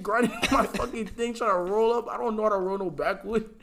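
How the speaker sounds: normal talking voice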